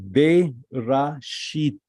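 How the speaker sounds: "Berashit" very slow, each syllable drawn out; slow reading. Shown as a demonstration. Teacher reading the first word of Torah to students, very slow. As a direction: stretch out "Berashit" so every vowel is heard. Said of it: "read it for you slowly".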